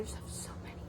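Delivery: soft unvoiced whisper; confiding whisper about her pouch stash. Real, not acted. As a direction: whisper it like a guilty secret. Confessing pouch hoard.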